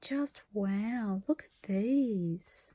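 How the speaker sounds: awed hushed whisper; slow unvoiced wonder. Real, not acted. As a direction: breathe it, barely voiced. Awed at gift fabric.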